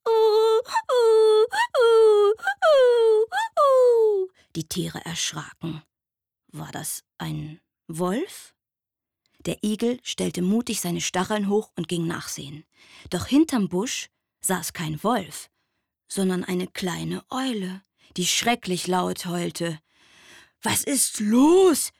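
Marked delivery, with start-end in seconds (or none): 0.1-4.3 high, loud, drawn-out owlet wail; terrible owl howling. A narrator's reading. Little owl howling in the foggy forest, loud wailing. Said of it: "ein schreckliches Heulen". 20.6-21.9 hedgehog asking. A narrator's reading. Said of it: "fragte der Igel".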